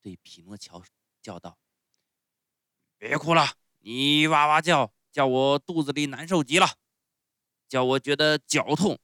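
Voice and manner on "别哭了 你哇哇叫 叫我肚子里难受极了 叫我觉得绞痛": loud, blustering ogre voice; put-on fierce shout. A narrator's reading. Showman yells at wailing Pinocchio, fierce bluster. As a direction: bellow to silence the crying child. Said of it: "装出很凶的样子".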